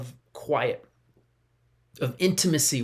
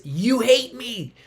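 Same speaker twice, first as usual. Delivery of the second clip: put-on higher child voice, wailing you hate me; mock child yelling; 7 semitones higher than usual. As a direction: play the hurt kid shouting back. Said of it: "starts yelling and says".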